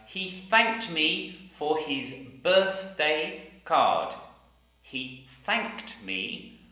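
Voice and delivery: He thanked me said slowly, th sounds clear; He thanked me, drawn out; slow careful sentence. Shown as a demonstration. Teacher modelling the sentence slowly. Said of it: "sentences with the T H sound".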